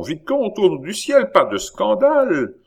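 high-pitched, loud plea; cried out. A narrator's reading. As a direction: implore him urgently in a high voice. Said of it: "criait la voix de Chon". (supplication criée d'une voix aiguë)

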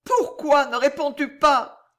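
high, loud, urgent question; acted impassioned outcry; crying out. A narrator's reading. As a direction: demand an answer from your lover. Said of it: "s'écria-t-elle".

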